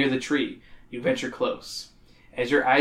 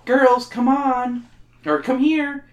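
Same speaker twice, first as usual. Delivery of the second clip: calling mother; 9 semitones higher, 3 dB louder, slower than usual. Acted. Woman calls the girls.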